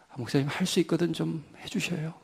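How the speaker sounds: a grudging, limp request to the pastor; half-hearted request. Retold or imitated. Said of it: "이게 아니죠". (마지못해 하는 부탁, 훨씬 작게)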